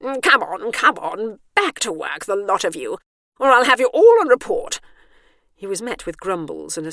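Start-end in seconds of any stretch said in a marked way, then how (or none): 0.0-4.8 loud, brusque orders, rising to a bark; brusque chivvying. A narrator's reading. Warden chivvies the staff back to work, brusque and loud. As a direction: herd them off like a stern warden. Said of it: "chivvy the staff away brusquely".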